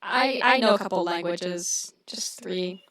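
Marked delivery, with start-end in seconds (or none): 2.1-2.8 whispered under the breath; whispered aside. Real, not acted. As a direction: slip it in as a whisper. Modest aside.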